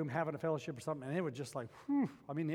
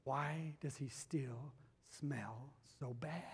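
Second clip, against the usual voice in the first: softer, slow puzzled musing; puzzled inner thought; 3 semitones lower, 9 dB quieter, much slower than usual. Retold or imitated. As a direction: wonder it quietly to yourself. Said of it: "I remember thinking".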